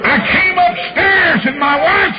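high, loud, slowed holler; preaching shout. Acted. Preacher telling the congregation, slow high shout. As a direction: ride the climax at full voice.

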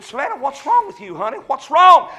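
mild mock calling of a worried dad; worried father calling. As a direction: call out to her, a hint of alarm. Father calling his crying daughter.

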